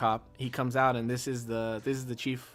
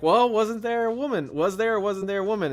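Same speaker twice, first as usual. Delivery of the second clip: cop demanding; 8 semitones higher, 5 dB louder than usual. Retold or imitated. Cop interrogating a suspect.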